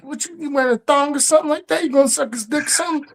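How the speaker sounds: high-pitched mimicking voice; Patrice. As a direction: imitate him in a high, halting voice. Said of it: "when Patrice was like".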